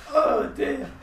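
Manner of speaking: high sigh tipping back into laughter; worn out from laughing, still going; tearful laughter. Real, not acted. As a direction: try to recover, then crack up. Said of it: "he thinks I'm crying".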